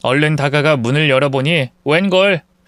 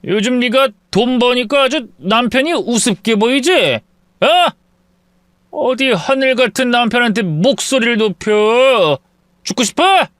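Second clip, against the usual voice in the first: raised voice; 7 semitones higher than usual. Acted. Drunk husband pushes wife's shoulder, shouting threats. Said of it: "마구 언성을 높였습니다".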